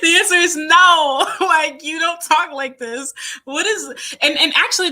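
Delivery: sarcastic high-pitched reply while laughing; mock high answer.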